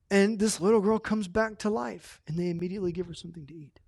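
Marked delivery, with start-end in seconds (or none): 2.3-3.8 rushed whispered aside; fast whisper. Real, not acted. Preacher to the congregation, a fast whispered aside. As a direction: whisper it fast, under the breath.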